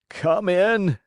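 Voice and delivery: the carpenter, calling out from the floor; weak carpenter. A narrator's reading. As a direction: call come in up high.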